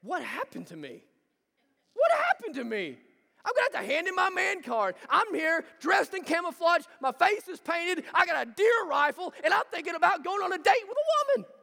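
a lovesick hunter mocking himself; smitten hunter. Hunter in a deer stand, mocking himself about a girl. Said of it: "you're thinking".

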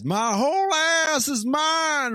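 high, drawn-out imitated yell; hammed-up slow holler; mock movie-line holler. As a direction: stretch it into a loud, high mock wail. Mimicking a film line.

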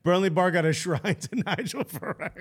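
chuckling through the story of the bar; laughing. Real, not acted. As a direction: laugh while telling it. Joking about the Burnley bar.

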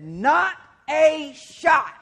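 high, very slow, loud scoffing denial; cocky Peter. Acted. Peter boasting to Jesus he'll never deny him, hammed-up. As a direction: Peter brushing Jesus off with full bravado.

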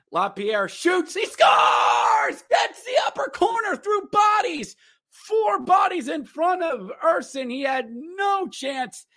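high, shouted goal call like a broadcaster. Real, not acted. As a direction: erupt as if calling the goal live. Calling a goal excitedly.

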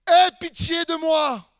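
loud, drawn-out plea for mercy; crying out. Retold or imitated. Woman calling out to Jesus for mercy, crying out. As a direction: cry it out slowly, high and loud. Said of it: "elle se mit à crier".

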